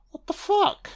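high, squeaky disbelief; a sudden squeaky What the fuck?; shocked squeal. Real, not acted. Shocked at the wrong house.

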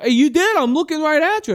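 high, loud mock accusation; heated accusation. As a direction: act out an indignant woman's accusation. Acted accuser in heated argument.

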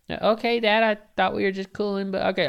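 put-on high, meek boy's protest; whiny kid. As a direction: push back timidly like a small kid. Kid answers yelling dad.

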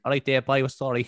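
lightly imitated East Cork lilt; Cork accent. Imitating an Armenian shop owner greeting customers, Cork accent. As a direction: mimic a shopkeeper's picked-up Cork accent. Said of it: "he's living in East Cork".

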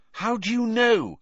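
high, loud challenge; sharp retort. A narrator's reading. Hermione challenging Ron about Bagman, retorting sharply. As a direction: fire it straight back, pitched high. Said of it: "Hermione shot back".

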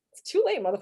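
high-pitched scolding outburst; fed-up heckle at a character; exasperated viewer. Retold or imitated. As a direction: tell off the screen in exasperation. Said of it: "it's like".

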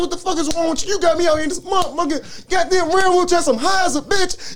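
high-pitched, whining mock rant; cussing out. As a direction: replay a panicked cussing-out, voice way up. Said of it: "Just cussing them out".

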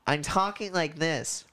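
high, slow voice sent up into the nose; slow demo with a nasal, high placement. Shown as a demonstration. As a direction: speak from the top of your nose. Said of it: "speaking from the top of my nose".

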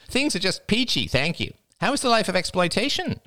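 high-pitched, nasal needling; Jada, high and taunting. A narrator's reading. Jada baiting her cousin Oliver, high mocking voice. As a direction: needle him in a high, showy voice.

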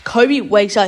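ordinary talk

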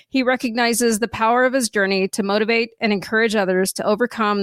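podcast intro read. Acted. Reads the guest intro.

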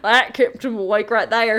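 mock Southern, mouth full of grit; bad southern accent. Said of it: "that's my southern accent for someone who has grit in their mouth".

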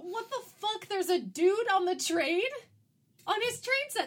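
high, loud disbelief; shocked confusion; baffled. Real, not acted. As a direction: react like you can't believe what you see. Baffled reaction to movie scene.

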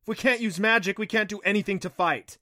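loud, high-pitched alarm; desperate. A narrator's reading. Hopeless question in a battle.

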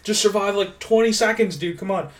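high, loud desperate urging; urgent cheering. Retold or imitated. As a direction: beg the fighter to hang on. Cheering fighter on.

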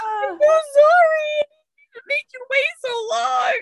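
high, slow, loud sobbing apology; hammed-up sobbing. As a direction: wail it through tears. Crying apology to her dog.

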